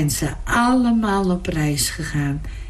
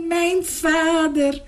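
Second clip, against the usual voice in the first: tearful repeat; 8 semitones higher, slower than usual. Acted. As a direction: let it break into happy tears, slowly. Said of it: "riep hij".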